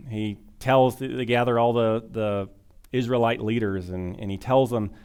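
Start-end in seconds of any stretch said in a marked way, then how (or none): none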